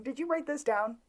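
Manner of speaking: ordinary delivery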